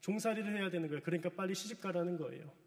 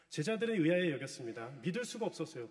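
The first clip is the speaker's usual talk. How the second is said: ordinary delivery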